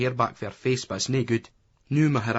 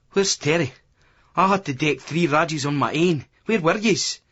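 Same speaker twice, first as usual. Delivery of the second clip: higher, aggrieved challenge; angry demand; 5 semitones higher, 4 dB louder than usual. A narrator's reading. Boxer to absent mates after a fight, angry demand. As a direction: demand answers, pitch climbing at the end. Billy accuses his mate.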